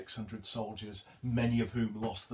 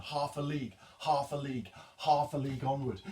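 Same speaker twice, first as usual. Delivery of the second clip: "half" stressed, "a league" light, driving on; rhythmic stress on "half"; galloping rhythm; 2 semitones higher, faster than usual. Shown as a demonstration. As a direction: build the gallop through "half a league onward". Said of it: "the rhythm of the galloping horses".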